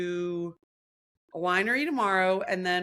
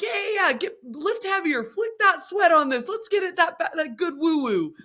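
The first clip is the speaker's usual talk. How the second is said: hyped instructor; 9 semitones higher, 6 dB louder than usual. Retold or imitated. Imitating the instructor cheering class, higher pitched. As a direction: egg the riders on like a cheesy coach. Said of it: "and being like".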